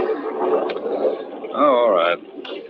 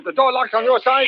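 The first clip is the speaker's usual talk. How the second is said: alarmed. Acted. Husband alarmed by thump on car.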